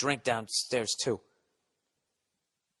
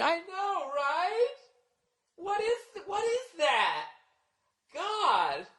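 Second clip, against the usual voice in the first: very high, soft catty squeal; mock girlfriends gossip; 5 dB quieter, slower than usual. Mocking women gossiping about men. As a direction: gossip like a girl at a wine bar. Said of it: "with your female friends just going".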